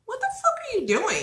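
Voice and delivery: high-pitched incredulous question; retold sister's high voice; imitated baffled sister. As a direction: squeak it in mock bewilderment. Acts out sister finding her.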